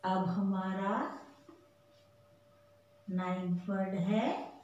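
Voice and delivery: ordinary talk, nothing marked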